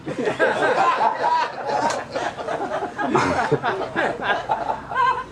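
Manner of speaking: long high cracking laugh; wheezing high laughter that keeps going. Real, not acted. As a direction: laugh helplessly at the absurd answer.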